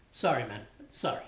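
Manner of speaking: ordinary talk, nothing marked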